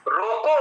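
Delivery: रोको lifted at the end, then a clear break; pause after रोको. Shown as a demonstration. Teacher placing a comma to show meaning, pause after रोको. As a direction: raise रोको and stop as at a comma. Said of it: "एक अल्प विराम दीजिए".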